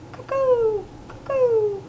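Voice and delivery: high, hooting cuckoo call. A narrator's reading. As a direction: sing out like a cuckoo. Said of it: "The cuckoo called".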